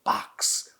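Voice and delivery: slow, whispered I have opened Pandora's box; slow eerie whisper, as if peering into the box; vampire whisper. Shown as a demonstration. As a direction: look down your nose and breathe it like a vampire. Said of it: "Like you're looking into it".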